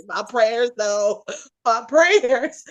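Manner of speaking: the co-host's reaction to her prayers, lamented in a lightly exaggerated voice; mock whine. A hint of a mock whine. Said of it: "You're like".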